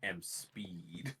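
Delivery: very slow whisper; whispered, stretched-out catchphrase; whispered movie catchphrase. Retold or imitated. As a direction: draw it out in a hushed whisper. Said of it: "you gotta remember, it's".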